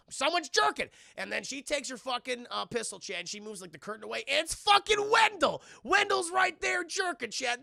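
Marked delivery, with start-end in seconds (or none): 0.1-0.9 alarmed exclamation. Acted. Mimics a shocking overheard discovery. 4.3-7.6 high, loud shouting; outraged reveal. Real, not acted. Outraged reveal while retelling the scene.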